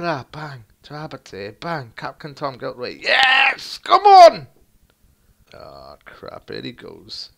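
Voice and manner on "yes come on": high, loud shout; triumphant yell. Real, not acted. Gamer celebrating a goal. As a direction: roar it out.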